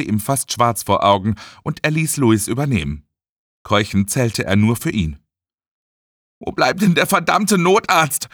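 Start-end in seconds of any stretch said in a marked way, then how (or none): 6.4-8.3 high, exasperated outburst; frantic cursing. A narrator's reading. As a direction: curse impatiently in an emergency. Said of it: "fluchte er". (aufgebrachtes Fluchen)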